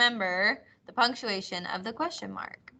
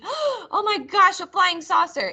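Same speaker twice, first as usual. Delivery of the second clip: amazed excitement; 9 semitones higher than usual. Shown as a demonstration. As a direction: sound truly amazed by a UFO. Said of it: "It would not be".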